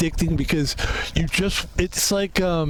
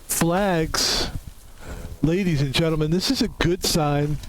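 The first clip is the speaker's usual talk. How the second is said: usual speaking voice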